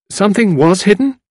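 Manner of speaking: lightly voiced lady perking up; Tuppence interested. A narrator's reading. Tuppence prompts, cheering up.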